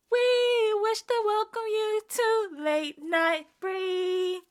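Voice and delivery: very high, very slow munchkin welcome. Pretend. Welcoming viewers to the show in a munchkin voice, very high and slow. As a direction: stretch it out high like a tiny munchkin. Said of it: "That was so ugly".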